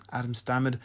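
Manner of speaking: plain reading voice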